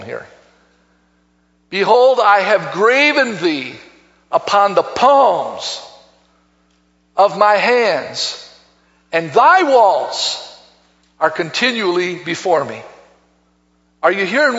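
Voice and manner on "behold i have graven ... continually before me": slow, weighty Bible reading with long pauses; solemn scripture declamation. Acted. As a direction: proclaim the verse slowly, phrase by phrase. Reads scripture to congregation.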